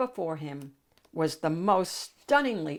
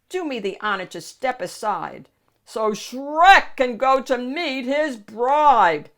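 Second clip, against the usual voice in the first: commanding; 6 semitones higher, 8 dB louder than usual. Acted. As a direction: order it grandly. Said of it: "Shrek commanded".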